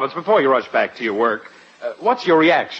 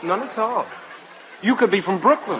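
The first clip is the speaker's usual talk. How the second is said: sarcastic compliment; 6 semitones higher than usual. Acted.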